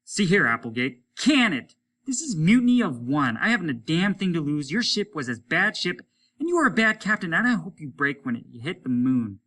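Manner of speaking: loud, high, bitter rant; furious outburst. Acted. Hollis yelling at his old captain, furious and loud. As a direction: spit it out with nothing to lose.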